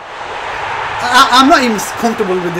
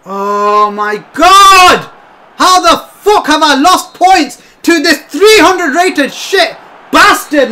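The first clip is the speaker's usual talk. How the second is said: loud, high, slow angry shout; seething shouted rant; furious rant; 6 semitones higher, 6 dB louder, slower than usual. Real, not acted. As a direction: rage at the opponent. Raging after losing points.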